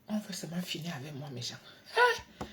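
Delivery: usual speaking voice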